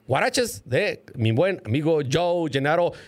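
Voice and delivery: usual speaking voice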